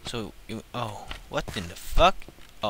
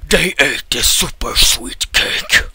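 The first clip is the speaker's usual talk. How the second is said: slow, louder, theatrical line; dramatic repeat; 2 semitones higher, 10 dB louder, slower than usual. Acted. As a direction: drag out "They ate the super sweet cake" for effect.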